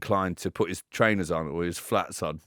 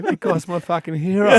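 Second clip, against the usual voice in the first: laughing; 9 semitones higher, 7 dB louder than usual. Retold or imitated. Laughing at the physio.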